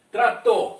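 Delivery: Trator, loud, with a strong final -tor; stress on last syllable. Shown as a demonstration. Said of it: "qual é a sílaba mais forte". (Trator com força na última sílaba)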